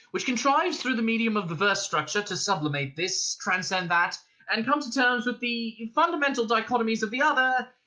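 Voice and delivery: high, mounting flourish; triumphant crescendo. A narrator's reading. Arthur on the home stretch, building to triumphant crescendo. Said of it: "He was reaching a triumphant crescendo".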